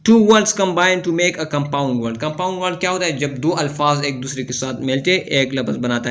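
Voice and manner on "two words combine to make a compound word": slow, careful read-aloud of the rule; slow textbook reading. Acted. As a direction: spell the rule out slowly for students. Reads textbook rule slowly. (धीमी किताबी पढ़ाई)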